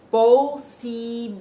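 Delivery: POSIBILIDAD slow and loud, syllable by syllable: po-see-bee-lee-DAD; drawn-out Spanish syllables in POSIBILIDAD; slow Spanish model. Shown as a demonstration. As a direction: sound out POSIBILIDAD with pure O and ee vowels. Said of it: "So, let's change them".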